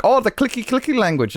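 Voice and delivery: high-pitched mocking quote; mock ignorant teasing. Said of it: "people make fun of 'em".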